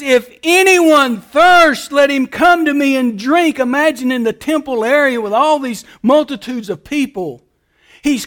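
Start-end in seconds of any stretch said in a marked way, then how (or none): 0.0-3.6 slow, loud call, stretching each word; crying out. Shown as a demonstration. Preacher voicing Jesus crying out in the temple, loud and slow. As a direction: call out to the whole temple crowd. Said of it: "So he cries out".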